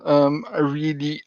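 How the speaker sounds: ordinary talk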